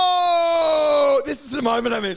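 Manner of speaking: loud, high mocking shout; mocking bullies. Bullies jeering at the kid standing up, loud mock shout. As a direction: jeer at him in a loud high voice. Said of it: "And then they're like".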